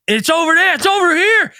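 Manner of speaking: high, urgent mock call across a field; excited calling out. Retelling the searchers' field scene, excited mock calling. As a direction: call out like a searcher spotting something.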